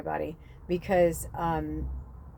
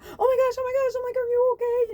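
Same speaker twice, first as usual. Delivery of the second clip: very high, loud alarmed cry of worry; panicked concern; 6 dB louder, faster than usual. Retold or imitated. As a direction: shriek out in alarm for the hurt snake. Horrified after hurting the snake.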